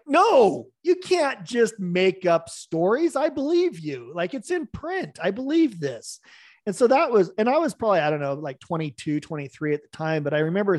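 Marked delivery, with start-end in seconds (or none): 0.1-6.2 young reader's mock disbelief at the author; indignant protest. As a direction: protest like you've been betrayed. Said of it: "I'm like".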